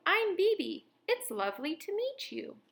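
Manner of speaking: cheerful kitten Bebe. A narrator's reading. Bebe the cat speaks.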